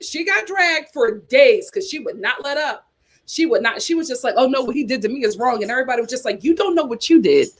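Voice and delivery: ordinary talk, nothing marked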